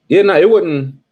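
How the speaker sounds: high, loud mock protest. Denying he cried, loud and high to the crew. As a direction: yelp it out, mock defensive.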